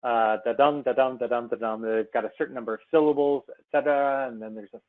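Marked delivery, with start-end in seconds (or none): 0.4-1.8 da-dum chanted weak-strong, stress on dum; iambic rhythm demo. Shown as a demonstration. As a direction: tap out the iambic beat da-DUM da-DUM. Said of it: "books are written in iambic pentameter".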